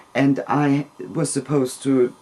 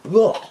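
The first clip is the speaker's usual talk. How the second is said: loud, high "Ugh" of disgust; 8 semitones higher, 5 dB louder than usual. Retold or imitated. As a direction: spit out the ugh, revolted. Father's disgust retold.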